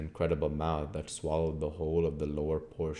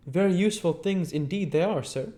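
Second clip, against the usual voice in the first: higher, lively innkeeper's wife; landlady voice; 13 semitones higher, 6 dB louder than usual. Acted. Landlady asking the guest's leave, higher voice. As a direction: voice Mrs Hall, pitched up and eager.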